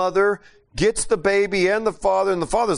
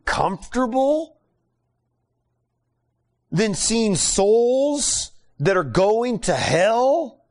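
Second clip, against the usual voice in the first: higher, slow, drawn-out rebuke; indignant God rebuking; 6 semitones higher, slower than usual. Retold or imitated. God scolding Jonah for loving the plant, slow indignant rebuke. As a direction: hold each word as if scolding in disbelief.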